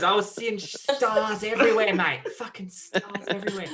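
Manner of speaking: lightly put-on Australian accent, laughing in places; shitty Australian accent. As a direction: play a rough Aussie bloke in Australian accent. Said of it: "Do your Australian accent".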